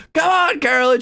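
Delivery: high, loud mock yell; host yelling encouragement. Imitating Jeff yelling at Carolyn, high mock yell. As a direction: hype her up like a host. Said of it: "every time he'd yell".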